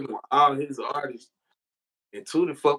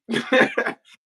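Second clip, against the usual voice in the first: burst of laughter; 11 semitones higher, 8 dB louder than usual. Real, not acted. Laughing at being whisked away.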